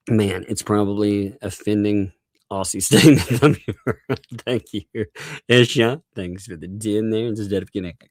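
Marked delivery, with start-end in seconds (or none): none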